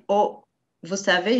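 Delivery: ordinary talk, nothing marked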